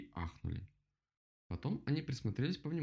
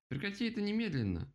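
high-pitched scolding; indignant; 12 semitones higher than usual. A narrator's reading. Rabbit orders the bear to stop, indignant. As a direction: snap the order at a raised pitch. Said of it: "возмутился кролик".

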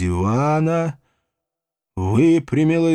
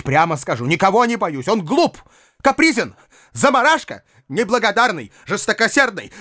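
put-on high-pitched, defiant girl's outburst; indignant young girl crying out, pitched high; girl's furious tirade; 9 semitones higher than usual. A narrator's reading. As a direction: rant in a high child's voice, pouring out the grievances. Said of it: "закричала Саша, вдруг вскочив со стула".

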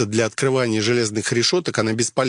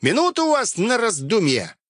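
shouted threat; 9 semitones higher than usual. Acted. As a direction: bark threats at the door. Bandit threatens locked-in cultists.

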